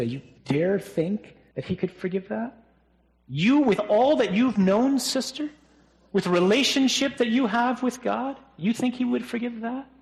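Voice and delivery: high, incredulous taunting voice; mock them in a high, needling voice; taunting devil. The accuser taunting a believer over past sins, high and incredulous. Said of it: "That's his voice. We've heard that voice".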